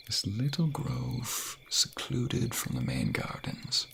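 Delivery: slow and hushed; slow reveal. Acted. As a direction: unveil it slowly. Showing secret place.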